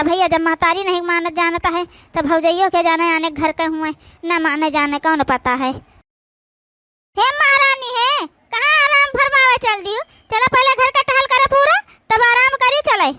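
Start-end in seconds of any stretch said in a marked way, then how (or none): 5.7-13.1 higher, mocking shout at the lazy daughter; scolding stepmother. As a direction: call her 'your majesty' with sharp scorn.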